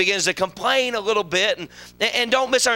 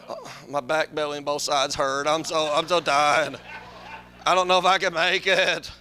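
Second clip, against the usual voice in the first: put-on low, soft groaning old-timer; mock groaning complainer; 8 semitones lower, 4 dB quieter than usual. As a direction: moan through every ache. Mocking complaining churchgoer.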